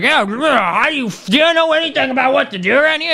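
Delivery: mock streamer voice. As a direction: parody the guy who corners people on the street. Mocking an obnoxious street streamer.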